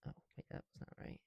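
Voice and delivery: quick unvoiced whisper; hurried whispered correction. Real, not acted. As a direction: whisper the slip fast. Whispered ASMR aside.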